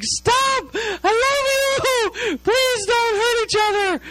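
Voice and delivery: high, drawn-out wailing plea; frantic praying mom. Retold or imitated. Mom praying over her fighting sons, frantic high wail. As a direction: imitate mom wailing prayers in a piercingly high voice. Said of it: "starts praying really loud".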